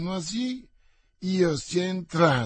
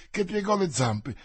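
ordinary delivery, nothing marked

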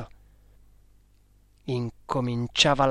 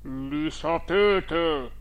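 very slow, solemn spirit reply; grave ghost voice; much slower than usual. A narrator's reading. Hidden boy answering as the dead uncle, grave ghostly tone. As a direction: draw it out gravely, like a phantom. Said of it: "risposi con voce grave".

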